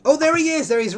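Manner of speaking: high, loud delighted cry; excited greeting. Real, not acted. Host spotting Peter join the game, delighted and loud. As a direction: glad to spot Peter at last. Player appears on stream.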